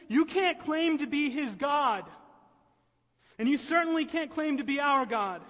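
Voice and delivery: loud, high shouts of indignation; outraged. Acted. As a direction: hurl it out as an offended, furious accuser. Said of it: "And then the rage kicks in".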